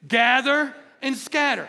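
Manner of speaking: fired-up call for the crowd to echo; call and response. Acted. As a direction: lead the room in a chant. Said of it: "say it with me".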